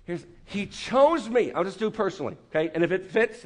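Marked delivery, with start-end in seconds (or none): none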